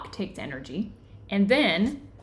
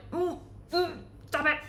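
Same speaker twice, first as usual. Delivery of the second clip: high, pinched hum, then a choked "stop it"; holding back tears; 7 semitones higher, 5 dB quieter than usual. Pretend. Acting out someone holding back tears, high and pinched. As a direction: fight the tears down in a squeezed voice.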